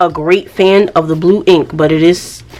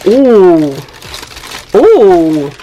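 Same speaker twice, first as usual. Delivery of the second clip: impressed ooh; 4 dB louder than usual. Real, not acted. Opening the pen pouch.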